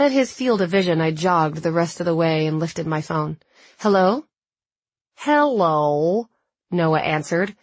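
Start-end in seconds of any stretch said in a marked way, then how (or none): 5.1-6.3 drawn-out mock American accent, rising; mimicked American accent. A narrator's reading. Noah echoes her phone greeting in mock American accent. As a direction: imitate her American accent, stretching the word. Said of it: "mimicking my American accent".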